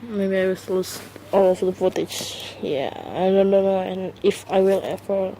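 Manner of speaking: normal talking voice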